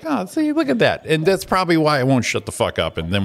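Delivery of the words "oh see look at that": very high smug gloat; smug squeak. Retold or imitated. To himself after the clerk gives in, high smug gloat. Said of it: "And I was just like".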